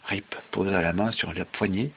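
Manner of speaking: ordinary delivery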